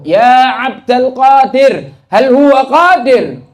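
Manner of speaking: put-on loud, high, slow slurred call of a drunk; drunkard calling out. A drunkard calls out to Sheikh Abdul Qadir, loud, high and slow. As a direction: drag the words out like a drunk stopping a sheikh in the street. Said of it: "Ditanya lagi sama Abu".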